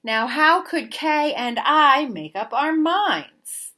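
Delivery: plain reading voice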